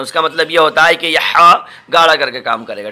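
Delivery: normal talking voice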